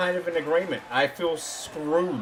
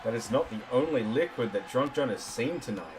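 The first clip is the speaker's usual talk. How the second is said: wrestling commentator; 4 semitones lower, 4 dB quieter than usual. Acted.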